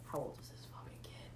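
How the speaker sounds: hushed whispered aside, retold; muttered disbelief. Thinking aloud about a younger guy, whispered disbelief. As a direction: whisper it under your breath. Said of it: "And I'm like".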